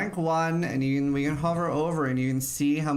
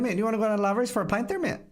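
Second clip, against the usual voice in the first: put-on quick, high Belfast accent; 7 semitones higher, faster than usual. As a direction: rattle it off like a Belfast local. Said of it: "Belfast has its own language".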